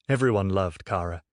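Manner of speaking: usual speaking voice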